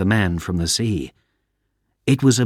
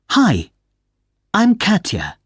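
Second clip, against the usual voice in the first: higher, cheerful voice for Katya; bright young woman; 11 semitones higher than usual. Acted. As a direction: greet her with a big smile, voiced higher.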